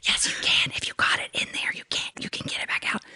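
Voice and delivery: firm soft whisper; hushed insistent reply; whispered reassurance. Retold or imitated. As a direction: whisper back calmly but firmly. Surgeon whispering reassurance back.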